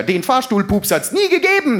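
high-pitched indignant denial; vehement denial. Acted. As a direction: deny it all with mock outrage. (hoch empörtes Abstreiten)

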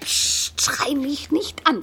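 hushed whisper, breath only. Acted. Wife hushes her husband in the night, whispering. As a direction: hiss it so nobody hears.

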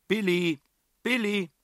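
high, loud calls across the woods; searching the woods for Billy; calling out. A narrator's reading. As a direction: call her name from afar.

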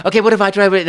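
high, loud eager plea. Retold or imitated. Eager actor asking for another take.

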